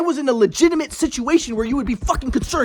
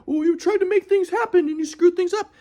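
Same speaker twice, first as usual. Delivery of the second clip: mock scolding grandpa, pitched higher; 9 semitones higher than usual. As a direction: toss off grandpa's reproach in a lightly mocking voice. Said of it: "my grandpa would be like".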